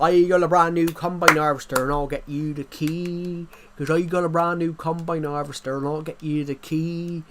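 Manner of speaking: put-on broad rural English farmer accent; old-time miner-and-farmer English accent, put on; local farmer accent. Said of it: "They sound like the old typical miners and farmers".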